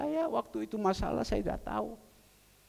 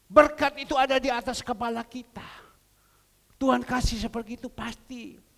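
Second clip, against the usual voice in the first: raised, driving sermon voice; preaching; 5 semitones higher, 5 dB louder than usual. Acted. Preacher urging the congregation, in a fervent sermon voice. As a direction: press the point to the church.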